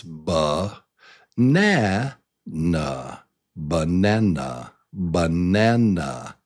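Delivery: ba-na-na, deliberate, with a clearer NAN; stress on middle syllable. Shown as a demonstration. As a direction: lean on the middle of banana. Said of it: "say it a little louder, a little clearer".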